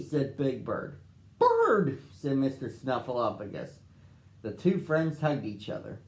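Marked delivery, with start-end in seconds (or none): 1.4-2.0 very high, loud cry; joyful greeting. A narrator's reading. Snuffy greeting Big Bird, high glad cry. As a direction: greet a long-missed friend.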